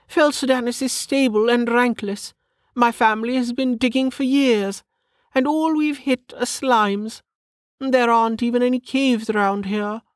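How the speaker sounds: high-pitched lady scoffing; high, haughty aristocrat voice; dismissive noblewoman. Acted. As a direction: dismiss the idea in a high lady's voice. Narrator voices Lady Parr, dismissive.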